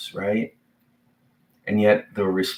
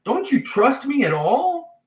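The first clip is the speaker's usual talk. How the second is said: incredulous plea; 4 dB louder than usual. Retold or imitated. Jesus questioning the disciples' faith, high incredulous plea. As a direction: ask if they have any faith. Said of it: "but he says".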